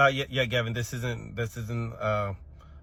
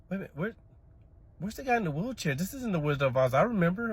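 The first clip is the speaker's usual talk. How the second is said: put-on higher, confused little-boy voice; puzzled kid; 7 semitones higher than usual. Confused child questioning the movie in class. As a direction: play it as a baffled child.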